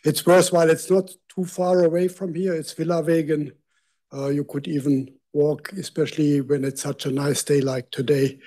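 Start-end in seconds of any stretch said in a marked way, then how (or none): none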